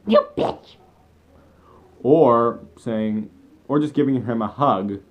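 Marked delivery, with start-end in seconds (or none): 0.1-0.8 much higher, squealed mock insult; mock angry slap line. Imagined girl slapping Ash, shrill mock outrage. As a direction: shriek it like a cartoon slap. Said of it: "slapping him across the face saying".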